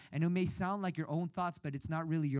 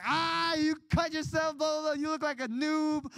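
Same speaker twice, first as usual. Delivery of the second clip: higher teasing voice of the homies; mocking friends; 10 semitones higher than usual. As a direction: roast him like a middle-school friend. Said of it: "They were like".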